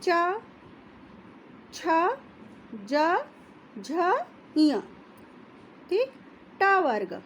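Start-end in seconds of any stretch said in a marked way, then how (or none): 0.0-4.8 slow, each of च, छ, ज, झ, इय set apart; slow letter demo. Shown as a demonstration. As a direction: say च छ ज झ इय one at a time. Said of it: "आप इनको बोलेंगे". (तालु से धीरे-धीरे उच्चारण)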